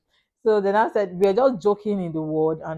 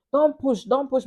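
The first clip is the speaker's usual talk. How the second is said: higher-pitched imitation of an urgent nurse; retold urgent warning; 6 semitones higher than usual. Retells nurse's urgent order.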